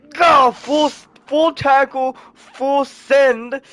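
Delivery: loud, high, punchy exclamations; hyped narration. A narrator's reading. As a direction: call the big hit with full excitement. Excited telling of a tackle.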